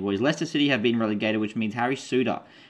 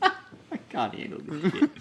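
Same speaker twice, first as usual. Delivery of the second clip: laughing; 7 semitones higher, slower than usual. Real, not acted. Laughing at Waluigi bit.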